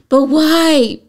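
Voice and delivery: put-on high, loud whining little girl; whiny child. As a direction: whine it like a small kid. Mother retells daughter's whining protest.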